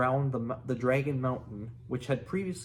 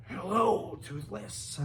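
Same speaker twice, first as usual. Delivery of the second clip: shrieky higher hello falling to a whispery end; attempted Dragonese; 6 semitones higher, slower than usual. Shown as a demonstration. Reader tries Hiccup greeting Toothless in Dragonese, shrieky. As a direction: try out a human speaking dragon language. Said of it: "I think that's how it would be".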